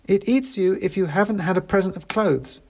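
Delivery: put-on patient teen girl. A narrator's reading.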